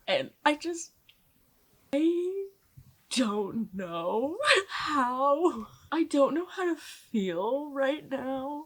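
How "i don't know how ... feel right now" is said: stunned, slow. Real, not acted. Overwhelmed after the imprinting scene.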